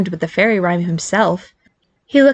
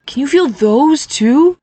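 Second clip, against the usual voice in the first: tag; 8 semitones higher, 3 dB louder, slower than usual. Acted. Dazed brother asks in wonder.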